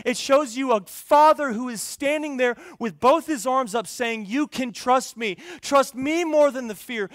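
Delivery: loud, high, forceful preaching; impassioned preaching. Real, not acted. Preacher voicing stubborn sinners and trustworthy Father, loud and impassioned. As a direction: preach it hard, voicing each side with urgency.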